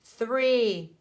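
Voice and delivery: usual speaking voice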